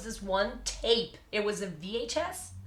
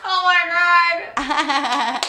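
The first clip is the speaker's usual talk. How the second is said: excited; 9 semitones higher, 13 dB louder, much slower than usual. Real, not acted. Reacting to the [name] impression.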